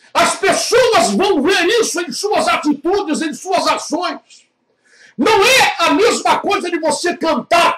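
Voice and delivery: high, loud fiery preacher; shouting sermon climax; preaching at full force. Acted.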